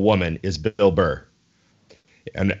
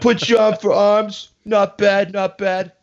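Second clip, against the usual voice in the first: higher mock mobster gym bro; Italian mobster; 9 semitones higher, slower than usual. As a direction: mobster voice, mock friendly threat. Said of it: "create a voice just for that".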